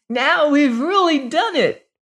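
high, loud shout of delight; joyful cry. Acted. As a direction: cheer it out with a triumphant whoop. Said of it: "he cried".

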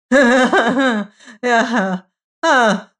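high, loud, laughing yeah; nervous laugh. Acted. Fortunato clinging to the joke: high nervous laugh. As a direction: force a laugh, high and shaky. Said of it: "The voice said".

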